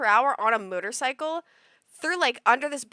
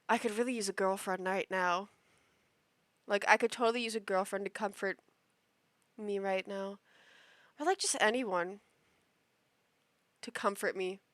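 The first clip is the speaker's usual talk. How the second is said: mock pity plea; 4 semitones lower, 8 dB quieter than usual. Mocking the guy's pity plea.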